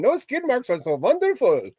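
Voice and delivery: mock Russian accent. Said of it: "you sound very Russian".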